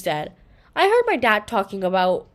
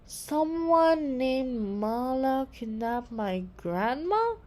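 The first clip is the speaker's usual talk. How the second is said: slow, soft, questioning read; a hint of a puzzled girl reading; reading aloud in disbelief; 3 semitones higher, 6 dB quieter, much slower than usual. A narrator's reading. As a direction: sound out the note slowly, unsure. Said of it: "Claire read it out loud".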